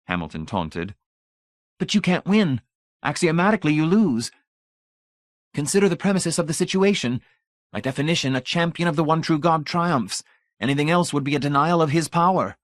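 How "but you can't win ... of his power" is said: high, proud voice of a zealot; zealous champion. Acted. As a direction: declare your faith with lofty pride. Brady's voice.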